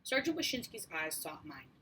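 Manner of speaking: ordinary delivery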